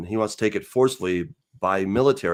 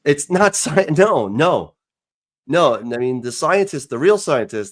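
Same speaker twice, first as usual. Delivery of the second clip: raised, incredulous objection; loud protest; 4 semitones higher, 6 dB louder than usual. Real, not acted. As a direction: cut him off loudly in disbelief.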